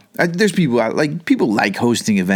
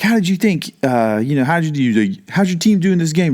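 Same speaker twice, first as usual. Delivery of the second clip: sideline reporter; 6 semitones higher than usual. Retold or imitated. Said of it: "the reporter would be like".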